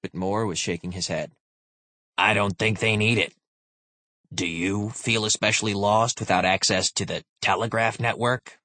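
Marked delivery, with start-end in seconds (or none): 2.2-8.6 lightly voiced dry old soldier; a hint of a weary officer; grizzled veteran. Moore voiced in narration.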